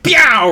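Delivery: loud, high mock gunshot; imitated gunshot. Streamer acts out somebody walking in shooting, mock gunshot. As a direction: punch out a drawn-out shot noise.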